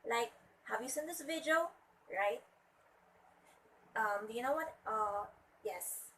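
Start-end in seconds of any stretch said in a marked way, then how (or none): 0.7-1.7 "have you seen this video" climbing in pitch to the end; rising question intonation. Shown as a demonstration. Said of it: "it's a rising intonation".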